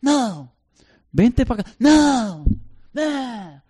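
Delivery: put-on defiant child. Child refusing to come to his parent, high and whiny. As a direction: refuse like a stubborn little kid.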